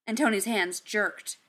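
ordinary delivery, nothing marked

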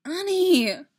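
high, loud plea; begging. A narrator's reading. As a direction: cry out the name, pleading. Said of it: "Padme begged".

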